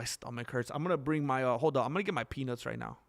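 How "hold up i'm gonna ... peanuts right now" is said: ordinary talk, nothing marked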